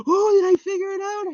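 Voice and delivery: high squealing delight, half crying out; thrilled at cracking the name, very high; giddy excitement. Acted. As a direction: squeal of triumph. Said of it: "look at me being a genius".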